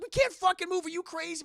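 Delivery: high, loud angry shout; panicked anger. Retold or imitated. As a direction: snap at him in fear. Friend refusing to move.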